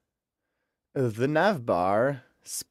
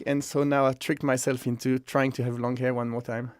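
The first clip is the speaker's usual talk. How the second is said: ordinary delivery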